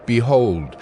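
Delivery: usual speaking voice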